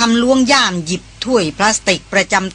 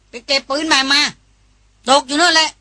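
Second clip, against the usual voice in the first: put-on raised, higher-pitched bark at the old man; roaring snap; 6 semitones higher than usual. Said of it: "ตวาดลั่น".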